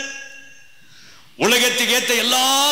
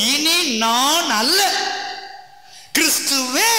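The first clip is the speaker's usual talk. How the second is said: preaching; 4 semitones higher than usual. Acted. Raised preaching on salvation.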